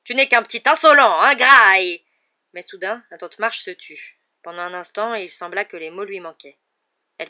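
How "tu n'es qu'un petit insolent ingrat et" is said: very loud, high, swelling yell; hurlement furieux. A narrator's reading. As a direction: scream insults until rage chokes off the words. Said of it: "hurla la tante Marge en s'enflant de colère". (cri de rage qui enfle)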